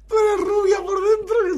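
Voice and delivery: shrill outraged cry; outraged shout. Real, not acted. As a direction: yell it in disbelief. Outraged at insult to caller.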